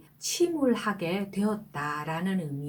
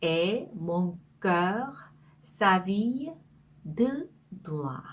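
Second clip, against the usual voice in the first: each chunk of the line said very slowly with pauses; slow phrase by phrase; much slower than usual. Shown as a demonstration. Teacher reading the French line to students, slow and chunked. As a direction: break the sentence into slow pieces for learners. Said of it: "그 다음에".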